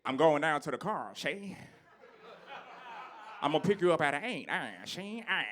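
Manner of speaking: put-on lightly done old-movie leading man; a hint of a stiff 1950s film actor; quick, mannered old-movie delivery; old fifties movie voice. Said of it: "how they sounded back then".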